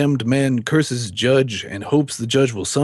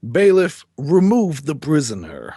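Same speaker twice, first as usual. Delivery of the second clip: sharp raised call to the bailiff; commanding order; 4 semitones higher, 3 dB louder than usual. Acted. Judge calling the bailiff to take the prisoner away, raised and commanding. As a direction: bark the order across the courtroom.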